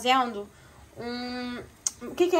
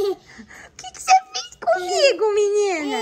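hammed-up wail, much higher; mock lament; 11 semitones higher, slower than usual. Mother mock-wailing at her daughter over the toppled tower. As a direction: pretend to be heartbroken, playfully.

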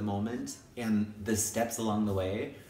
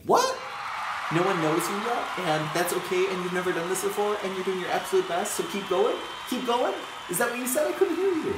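high playful encouragement; 8 semitones higher, 3 dB louder than usual. Acted.

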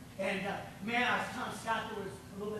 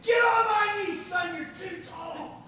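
high shouted order, then a laughing slower finish; drill sergeant shout; 8 semitones higher, 4 dB louder, slower than usual. Retold or imitated. Drill sergeant ordering a tall recruit down, mock shout. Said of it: "that drill sergeant said".

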